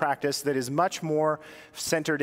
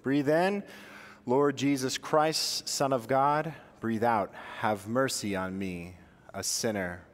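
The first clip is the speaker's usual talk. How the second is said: guided breath prayer; 4 semitones lower, slower than usual. Shown as a demonstration. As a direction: lead them through a breathing prayer, unhurried. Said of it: "Here, let's do it".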